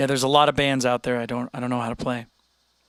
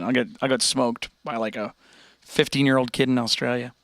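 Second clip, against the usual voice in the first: usual speaking voice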